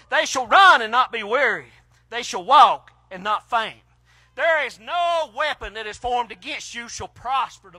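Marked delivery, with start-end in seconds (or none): 0.1-3.8 loud, ringing verse; loud proclaiming. Acted. Preacher crying out the verse to the congregation, loud. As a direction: proclaim it to the back row. Climax of scripture reading.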